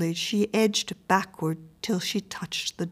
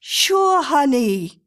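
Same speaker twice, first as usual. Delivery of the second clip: high, loud, easy reassurance; consoling neighbour; 7 semitones higher, 5 dB louder, much slower than usual. A narrator's reading. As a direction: comfort her in a raised, familiar voice. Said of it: "consoled the woman".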